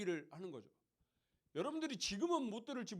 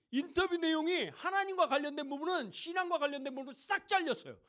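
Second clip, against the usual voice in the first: raised, louder indignant preaching; indignant emphasis; 6 semitones higher, 5 dB louder than usual. Real, not acted. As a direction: let the outrage ring out. (높고 크게 분개하듯)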